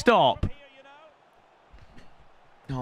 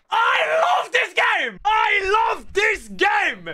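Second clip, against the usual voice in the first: high, slow exaggerated yell; over-the-top celebration; hammed-up cheer; 12 semitones higher, much slower than usual. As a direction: belt it like a cheesy announcer.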